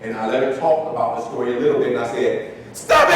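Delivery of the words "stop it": high, loud "Stop it!" yelled; sharp shout. Retold or imitated. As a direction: snap "Stop it!" out to break her mood. Counselor interrupts student third time.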